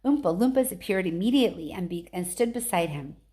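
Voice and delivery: ordinary delivery, nothing marked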